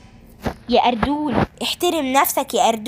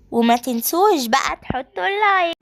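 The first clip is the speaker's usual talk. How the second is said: ordinary delivery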